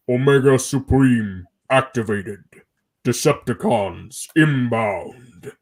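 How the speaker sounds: deep, slow robot announcement; booming giant robot voice; Omega Supreme voice. Acted. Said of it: "the line I did was".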